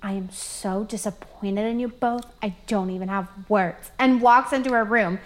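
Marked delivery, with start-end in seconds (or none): 0.0-3.9 disappointed mom. Retold or imitated. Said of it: "my mom just looks at us and goes".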